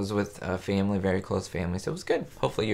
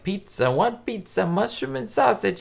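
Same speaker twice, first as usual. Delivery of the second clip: higher, exaggerated Italian waiter accent; 11 semitones higher than usual. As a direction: pitch it up like a hammed-up Italian pizza waiter. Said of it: "He does, like, an Italian accent".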